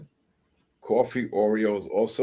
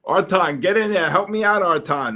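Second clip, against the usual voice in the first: loud, higher call for backup; 6 semitones higher, 4 dB louder than usual. Real, not acted. Calling Artan over for help, loud plea. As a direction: summon Artan to the rescue.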